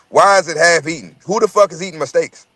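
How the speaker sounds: ordinary talk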